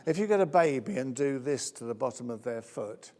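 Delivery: usual speaking voice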